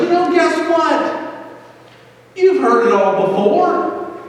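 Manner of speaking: hint of a Southern preacher's accent; rising Southern preacher lilt. Real, not acted. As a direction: voice climbs into a high, preachy Southern twang. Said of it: "Man, I'm sounding like a Southerner".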